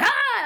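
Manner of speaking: a short high mock scream Ah; mock shriek. Host imitating characters reacting to Bridget, mock shriek. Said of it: "she's like".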